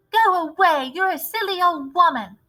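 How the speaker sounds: high, sharp brush-off; high scornful dismissal. A narrator's reading. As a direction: wave the old woman off with scorn. Mrs Jenkins dismissing grandmother.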